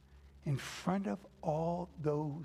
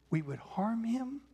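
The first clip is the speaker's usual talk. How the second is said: usual speaking voice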